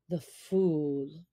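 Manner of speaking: low, slow, growled protest; angry. Acted. Father Wolf angrily answers the jackal, low and slow. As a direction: bristle at the news, low and slow.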